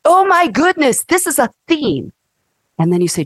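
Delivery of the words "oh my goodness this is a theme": sudden realization. Pretend. Acted realization.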